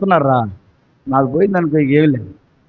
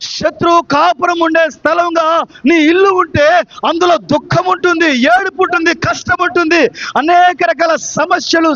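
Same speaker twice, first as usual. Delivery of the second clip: impassioned, raised sermon delivery; full-force preaching; 6 semitones higher than usual. Real, not acted. Preacher warning the congregation of trouble at home, full-force preaching. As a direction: drive each phrase out to the congregation.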